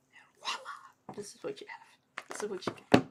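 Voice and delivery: whispered reveal, like a magic trick; playful whisper. Pretend. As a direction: breathe the words as a secret. Playful reveal of her mixed heritage.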